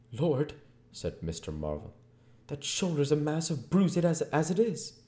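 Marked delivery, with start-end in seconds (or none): 0.1-0.5 high, loud frightened tramp; Marvel voice. Acted. Marvel cries out. 2.5-5.0 high, loud complaining tramp; Marvel voice. Acted.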